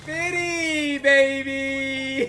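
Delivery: high sing-song call; playful calling out. Real, not acted.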